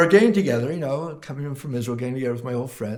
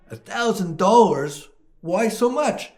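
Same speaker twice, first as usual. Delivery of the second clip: higher and louder, a disbelieving gasp at the price; shocked customer; 7 semitones higher, 3 dB louder, slower than usual. Acted. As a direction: react like the price is outrageous. Buyer shocked at parrot price.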